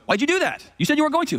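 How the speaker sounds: high-pitched mock outrage; hammed-up betrayed whine; indignant protest. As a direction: protest like a wronged victim, voice jumping way up. Bitten man protests in fable.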